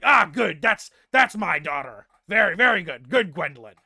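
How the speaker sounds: very loud, approving father voice; booming father. Acted. Father praising his daughter through the door, booming. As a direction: boom out the praise in big swooping phrases. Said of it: "and the father is like".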